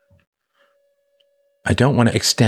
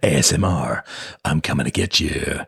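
low, softer menacing murmur; sinister ASMR voice; 7 semitones lower, 4 dB quieter than usual. Pretend. Host spoofs ASMR for viewers, mock sinister and softer. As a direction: creep up on them with a soft threat. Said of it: "that was a little sinister".

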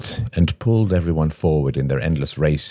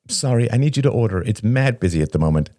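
fast, higher-pitched rush; hurried waitress; 6 semitones higher, faster than usual. A narrator's reading. Busy waitress needs the table to order, hurried. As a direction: rattle it off in a hurry.

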